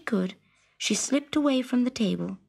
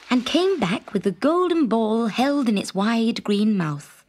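plain reading voice

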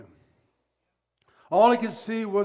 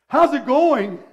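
mock cheery greeting; 6 semitones higher, 10 dB louder than usual. Servant mocking the calm greeting.

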